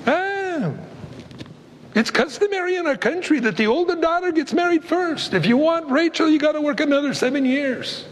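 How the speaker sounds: high, smug, wheedling father-in-law voice; sly Laban. Retold or imitated. Laban answering angry Jacob, smug and high. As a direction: sell the deal like a slick trader. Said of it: "He goes".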